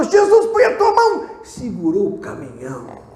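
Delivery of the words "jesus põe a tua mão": high, loud, slow plea; crying out to Jesus. Retold or imitated. Boy shouts to Jesus to stop the runaway truck, high and loud. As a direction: cry out to Jesus with all your force. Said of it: "chegou e falou".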